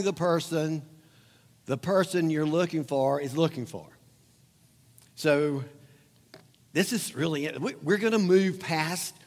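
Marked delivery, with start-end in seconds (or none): none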